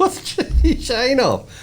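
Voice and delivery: laughing. Real, not acted. Laughing title call.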